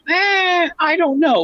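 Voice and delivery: high doubtful shrug of a voice; dubious high eh, I don't know; skeptical eh. Retold or imitated. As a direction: pitch it way up, unconvinced. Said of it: "I kind of was like".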